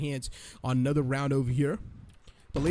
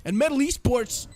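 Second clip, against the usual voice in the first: higher, louder hyped play-by-play; esports caster; 5 semitones higher, 3 dB louder than usual. Real, not acted. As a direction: build the hype through the clutch. Clutch round called live.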